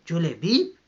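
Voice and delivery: retold shout of a name. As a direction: cry out the name in protest. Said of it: "she started shouting".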